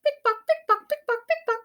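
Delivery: high, bouncy pick pock; ticking sound. Acted. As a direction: chirp it high like a ticking toy. Reading sound words in story.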